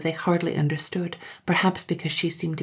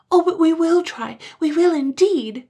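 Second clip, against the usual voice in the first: crying out; 13 semitones higher, 8 dB louder than usual. Acted. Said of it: "they cried".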